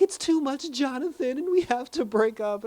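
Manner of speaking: high, whiny mock of a complaining girlfriend. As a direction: whine it like she's crying over nothing. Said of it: "She's like".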